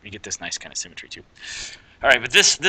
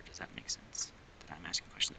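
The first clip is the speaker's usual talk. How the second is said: whispered aside; 13 dB quieter than usual. Real, not acted. Joking about asking absent viewers.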